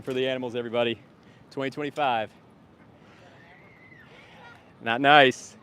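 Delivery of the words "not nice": loud. Real, not acted. Vegan streamer reacts to passerby.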